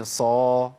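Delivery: "صا" with a heavy, dark s and backed vowel; emphatic sad sound. Shown as a demonstration. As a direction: sound out a full-mouthed "صا". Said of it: "غير الصاد أقول إيه؟".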